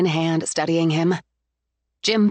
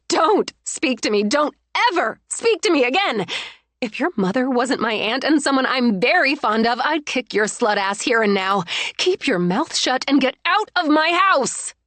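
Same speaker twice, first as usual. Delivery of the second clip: furious tirade; 5 semitones higher than usual. Acted. Bride cursing out her cousin, furious. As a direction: spit every word at her.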